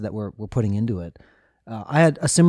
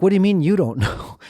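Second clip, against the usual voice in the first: higher-pitched disbelief with a laugh breaking in; retold bafflement, pitched up and laughing; mock incredulous; 7 semitones higher, 3 dB louder than usual. Diner questioning translators about an unknown dish, mock incredulous.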